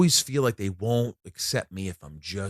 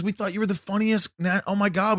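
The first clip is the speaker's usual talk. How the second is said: gushing fan; 8 semitones higher than usual. Retold or imitated. Retold star's gushing praise.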